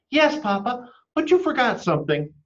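put-on high squeaky child voice; eager little chick; little chicken voice. A narrator's reading.

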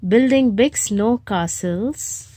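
ordinary delivery, nothing marked

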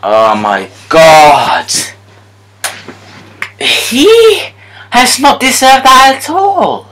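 high, slow outraged shouts; protesting the unfair loss to EA; furious slow disbelief. Real, not acted. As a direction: drag out every word in outrage.

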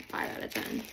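unvoiced whispered repeat; whispered aside. Real, not acted. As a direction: whisper the score to yourself. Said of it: "whispered repeat".

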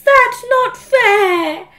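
loud, high, slow howl; boy howling at his mum over pocket money. Acted. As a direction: drag it out in protest. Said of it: "howled Henry".

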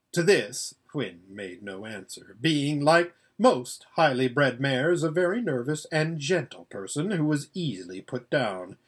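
plain reading voice

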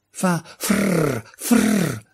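high, trilled "frrr" noise; little daisy making frrr sounds; exclaimed rustling imitation. A narrator's reading. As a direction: imitate the hiss of branches in a high voice. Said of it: "esclamò una margherita".

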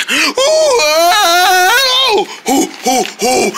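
long high wail, then short hoots; wailing oohs. Acted. Wailing while being rescued.

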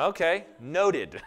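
slow, deadpan "Okay, noted."; dry pastor reply. Retold or imitated. Pastor answering a disagreeing couple, slow and deadpan. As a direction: drag it out, unimpressed. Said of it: "And you go".

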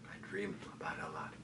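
hushed confession. Acted. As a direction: confide a recurring dream very quietly. Haunted dream recalled in monologue.

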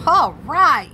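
high, loud cheer; loud, whooping agreement; excited yell. Acted. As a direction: yell it out with delight. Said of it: "yelled Arthur".

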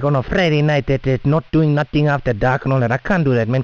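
usual speaking voice

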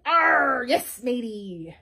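slow, growled pirate drawl; big pirate voice. Acted. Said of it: "The big voice said".

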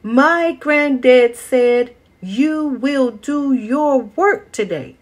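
high, loud and very slow; the boy puffs himself up to face the mule; biggest, strongest voice. Acted. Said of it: "in his biggest, strongest voice".

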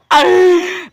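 sudden high laugh; high burst of laughter. Real, not acted. Vlogger laughs at himself, high laugh. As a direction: laugh out at the fumble.

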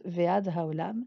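Ve'ad ha'olam said slowly, stress on ve'ad; careful Hebrew demo; slow Hebrew phrase. Shown as a demonstration. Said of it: "et jusqu'à la fin du monde".

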